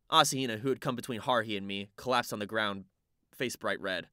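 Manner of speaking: ordinary delivery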